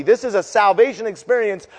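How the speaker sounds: ordinary talk, nothing marked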